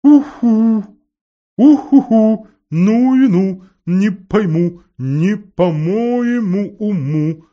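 high, loud hooting owl rhyme; owl hooting to herself. A narrator's reading. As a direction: hoot and mumble like a puzzled owl. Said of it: "ухая себе под нос".

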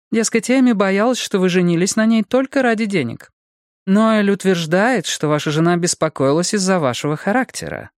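high-pitched detective voice; detective Bonnie, insistent. Acted.

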